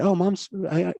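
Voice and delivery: lightly voiced little daughter; daughter's voice. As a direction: voice the little girl gently. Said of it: "And she said".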